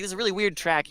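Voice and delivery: pitched well up, mimicking the amazement; retelling a friend's amazed verdict on a record, in a high voice; mock-amazed repeat. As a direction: echo him in a high marvelling voice.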